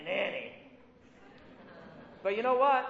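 ordinary talk

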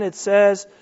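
normal talking voice

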